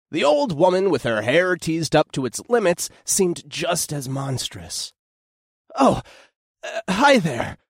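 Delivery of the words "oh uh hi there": panting greeting; breathless after running; out of breath. Acted. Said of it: "I panted".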